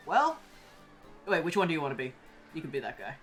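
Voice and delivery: usual speaking voice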